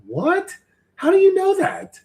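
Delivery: high-pitched disbelieving question; real astonishment. Real, not acted. Streamer questioning how a viewer knows his rating, astonished. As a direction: pitch leaping up, incredulous.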